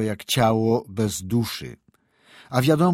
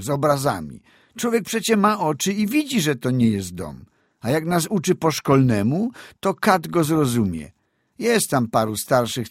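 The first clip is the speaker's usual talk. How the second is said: put-on higher, lively boy's complaint; complaining peasant boy; 7 semitones higher, 4 dB louder than usual. A narrator's reading. Boy telling mother and godfather about school, complaining. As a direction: grumble about school like a sharp village lad. Boy character voiced at length.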